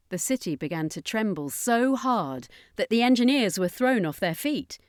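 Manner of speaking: plain reading voice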